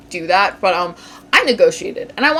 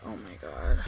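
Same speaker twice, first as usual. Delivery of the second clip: whispering; 11 dB quieter than usual. Real, not acted. Alone, fearing a scam.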